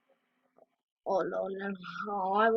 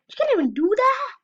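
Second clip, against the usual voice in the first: much higher, loud disbelief; incredulous outcry; 14 semitones higher, 8 dB louder than usual. Real, not acted. Mocking someone who fails a dance jump, high loud disbelief.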